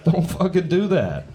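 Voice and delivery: ordinary talk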